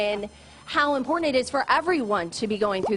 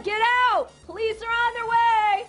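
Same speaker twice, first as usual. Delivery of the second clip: commanding shout; 10 semitones higher than usual. Pretend. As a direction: shout the warning. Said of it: "continue announcing yourself".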